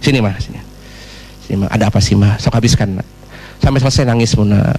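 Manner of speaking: low, soothing acted husband voice; comforting husband. Husband lets wife cry on his chest, low and soothing. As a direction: invite a crying wife in, pitched low. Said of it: "simpan, kasih dada saja".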